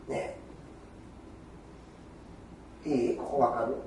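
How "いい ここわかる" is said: normal talking voice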